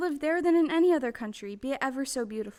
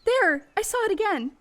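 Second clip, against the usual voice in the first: higher and louder, caught it happening; startled excitement; surprised exclaim; 6 semitones higher, 5 dB louder than usual. Acted. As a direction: blurt it out in amazement. Scarecrow winks again.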